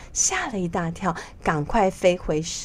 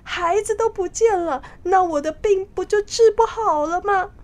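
high, panicked royal wail; frightened king; 11 semitones higher, 4 dB louder than usual. Acted. King fearing his illness can no longer be cured, frightened. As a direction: cry out in alarm, pitched high throughout. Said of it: "国王觉得很害怕的说道".

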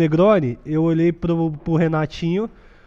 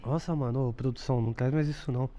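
whispering; 2 semitones lower, 9 dB quieter than usual. Real, not acted. Reacts to awful drink.

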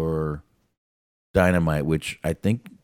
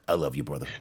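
usual speaking voice

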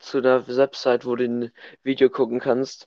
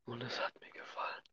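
whispering; 23 dB quieter, faster than usual. Acted. Whispered aside, unvoiced.

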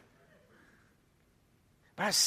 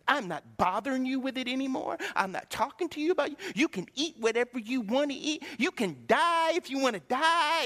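high-pitched mock tirade; imitated fed-up wife; 7 semitones higher than usual. Wife giving up on husband's diet, imitated fed-up tirade. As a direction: wash your hands of him in an acted huff. Wife's retort in retold fight.

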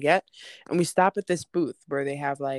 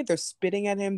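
ordinary delivery

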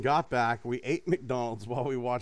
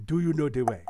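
Ugandan Knuckles accent, pushed from the back of the throat; throaty meme accent; faster than usual. Shown as a demonstration. Correcting his own take, throaty Ugandan Knuckles meme accent. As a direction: growl it up from the throat, meme style. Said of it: "Go to get it from the back of the throat".

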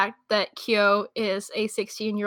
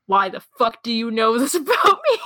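mock disbelief; 5 dB louder than usual. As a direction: act out a baffled protest, half laughing. Voices character's shocked reply.

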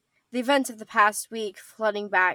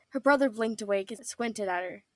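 ordinary delivery, nothing marked

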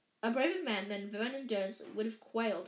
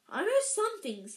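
put-on higher-pitched boy's protest; Harry's voice; 9 semitones higher, 6 dB louder than usual. A narrator's reading. Boy defending his schooling to the giant, high-pitched. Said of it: "I was doing it in the context of Harry".

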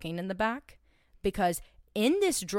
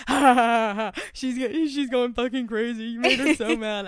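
mocking laugh; 3 semitones higher, 6 dB louder than usual. Quoting Aaron's gloating texts.

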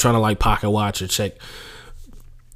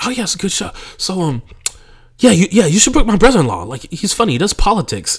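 much higher, eager pushy voice; imitating the co-owner pitching her comic brother-in-law; mock co-owner; 10 semitones higher, 4 dB louder than usual. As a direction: gush about the brother-in-law. Co-owner quoted again.

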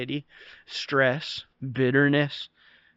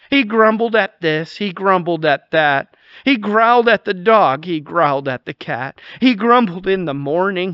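a lightly performed rhyming poem, a bit louder; poem recitation; 2 semitones higher, 4 dB louder than usual. Acted. Pastor reciting the grumbler poem to his congregation, sing-song. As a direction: recite it like a playful nursery rhyme.

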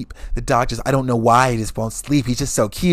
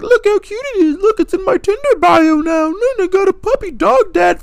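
high, loud, squealing dog-mom voice; mock the puppy owner, gushing; high girly gushing over the puppy; 8 dB louder than usual. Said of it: "They're like".

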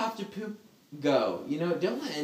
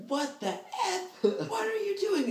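much higher, laughing mock outraged dad; angry dad impression; slower than usual. Kid recalls dad yelling at him, laughing mock fury. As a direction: play the furious dad through your own giggles. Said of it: "my dad is pissed".

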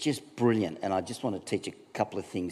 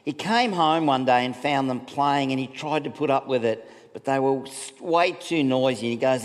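ordinary talk

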